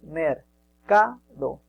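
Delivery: very slow mercado, broken into mer, ca, do; drawn-out syllables with gaps between. Shown as a demonstration. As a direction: sound out mercado one syllable at a time. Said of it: "tú lo silabeas".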